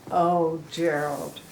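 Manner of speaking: ordinary delivery, nothing marked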